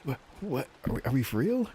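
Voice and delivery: high, stunned repeated what; mock shocked; disbelief. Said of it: "I was like".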